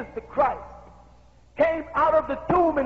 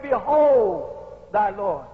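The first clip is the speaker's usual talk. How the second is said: slow, low and solemn, each word weighed; Jesus speaking; 5 semitones lower, 4 dB louder, much slower than usual. Acted. Preacher voicing Jesus to Mary, slow and low. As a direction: voice Jesus slowly and deeply. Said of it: "And he said".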